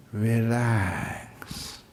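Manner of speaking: soft, stretched-out word; soothing release. Shown as a demonstration. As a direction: let the word loosen and melt away. Models letting the mind relax.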